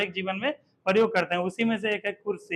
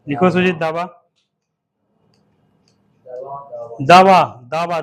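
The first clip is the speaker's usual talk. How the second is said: loud repeated दावा, lower; dictation call; 4 semitones lower, 11 dB louder than usual. Shown as a demonstration. Teacher dictating दावा to Sujeet, loud. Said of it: "लिखो दावा".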